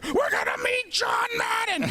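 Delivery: high, slow excited yell; excited father-in-law. Retold or imitated. Father-in-law leaping from his wheelchair, in a high excited yell. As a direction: shout it like a kid at Christmas. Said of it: "jumps out of the wheelchair".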